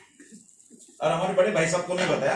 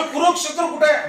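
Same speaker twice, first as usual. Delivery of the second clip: raised, insistent questioning; mock irritation; 7 semitones higher, 4 dB louder than usual. Interviewer asks the distracted candidate once more, mock irritated. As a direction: imitate the interviewer demanding an answer, high-pitched. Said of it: "गुस्से से देख रहा है".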